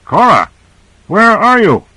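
high, urgent searching call; narrated shout, pitched well up; desperate shout. A narrator's reading. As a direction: shout desperately for someone lost. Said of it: "He shouted desperately".

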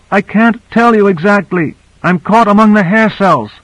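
high-pitched reply from someone in trouble; narrated distress, pitched well up; distressed call. A narrator's reading. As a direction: report your plight in a high, urgent voice. Lost woman calling over radio.

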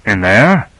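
tentative short question; mildly doubtful narrated query; uncertain. A narrator's reading. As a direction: ask it unsurely, a touch hesitant. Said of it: "he asked uncertainly".

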